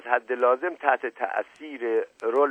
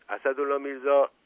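usual speaking voice

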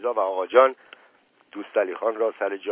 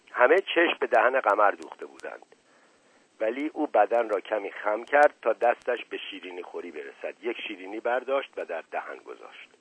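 ordinary delivery

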